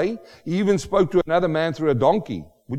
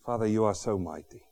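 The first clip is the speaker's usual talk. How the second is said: prayer; 7 semitones lower, 10 dB quieter than usual. Acted. Pastor leading congregation in closing prayer, soft and low. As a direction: speak gently to God.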